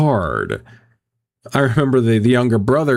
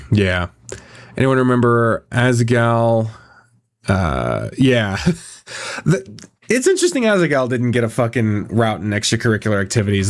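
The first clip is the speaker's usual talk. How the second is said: ordinary delivery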